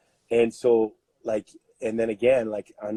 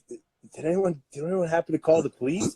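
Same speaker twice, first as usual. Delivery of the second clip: sarcastic question; 6 semitones higher than usual. Retold or imitated. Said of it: "and I'm like".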